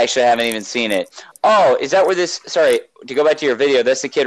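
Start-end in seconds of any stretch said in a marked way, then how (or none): none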